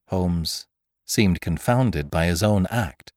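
usual speaking voice

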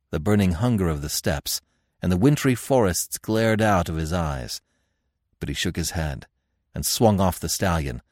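ordinary delivery

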